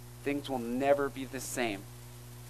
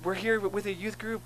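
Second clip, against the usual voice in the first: higher-pitched, lightly imitated teenage enthusiasm; eager teens; 7 semitones higher than usual. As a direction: voice keen teens inviting a stranger in, pitched up. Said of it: "And they're like".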